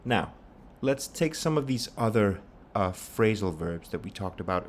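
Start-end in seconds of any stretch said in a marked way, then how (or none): none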